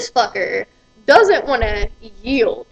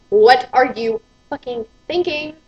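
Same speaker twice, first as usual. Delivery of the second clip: normal talking voice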